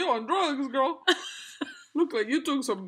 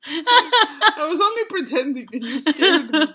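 laughing; 5 semitones higher, 10 dB louder than usual. Real, not acted. Scared by pretend bite.

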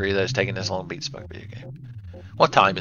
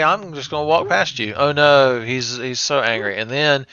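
normal talking voice

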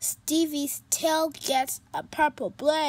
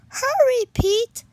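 higher, louder urging; 11 semitones higher, 9 dB louder than usual. Acted. Stevie urging Pete, excited. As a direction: hurry him along.

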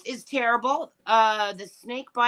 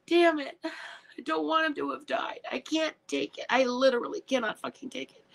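upset; 7 semitones higher than usual. Real, not acted. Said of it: "I'm a wreck".

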